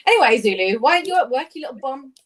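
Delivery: ordinary talk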